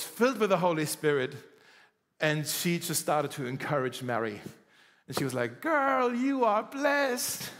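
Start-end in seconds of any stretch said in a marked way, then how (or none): 5.6-7.5 put-on high, slow girlfriend drawl on blessed; sassy girlfriend voice. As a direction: hype up your bestie, stretching blessed. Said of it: "I don't know if they talk like this".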